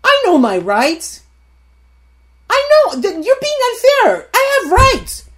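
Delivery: high, loud, indignant protest; hammed-up indignant wife to husband; indignant self. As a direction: demand your rights, all puffed up. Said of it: "I looked at him and I said".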